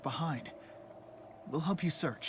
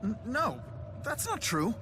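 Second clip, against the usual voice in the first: higher, stammering protest; flustered denial; 7 semitones higher than usual. Acted. Jude embarrassed by the spirit's praise, flustered. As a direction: deny it, caught off guard.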